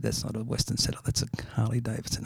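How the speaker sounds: quiet aside, almost whispered at the end; soft wife's voice. Acted. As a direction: say it under your breath like a wife nudging. Wife corrects husband in joke.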